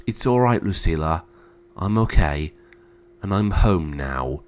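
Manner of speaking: low, soft comfort; reassuring. Acted. Brother comforting his sister, gently reassuring.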